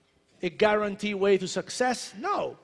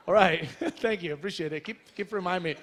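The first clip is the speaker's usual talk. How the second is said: ordinary delivery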